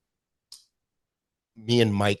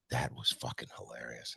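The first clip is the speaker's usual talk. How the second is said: 1 quiet gruff praise; 8 semitones lower, 13 dB quieter than usual. Retold or imitated. Old guy praises, retold.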